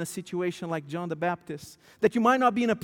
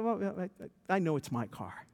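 much lower and softer, unbothered; mild hushed Wesley; 3 semitones lower, 7 dB quieter than usual. Retold or imitated. Quoting Wesley brushing off the pastor, lower and softer. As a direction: mumble it low like a calm elder. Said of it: "He said".

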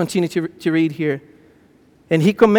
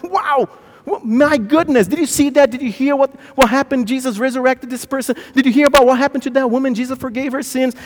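high, breathless run of astonished questions; amazed gushing; 7 semitones higher than usual. Acted. Followers trading news of miracles, amazed. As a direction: act out followers marveling to each other.

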